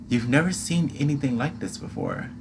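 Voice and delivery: plain reading voice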